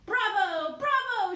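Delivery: high, loud bravo; shouting bravo. Acted. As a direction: cheer the act with full voice. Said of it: "shouted the Big Boss".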